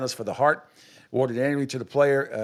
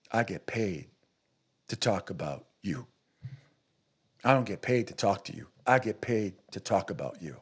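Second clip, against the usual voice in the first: mock Stephen A; 6 semitones lower, 6 dB quieter than usual. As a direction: drop low and quiet, sneer it like a self-important pundit. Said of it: "We would do bits on that for years".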